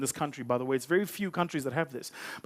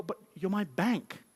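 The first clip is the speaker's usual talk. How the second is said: incredulous; 4 dB quieter, slower than usual. Acted. Customer protests disbelief.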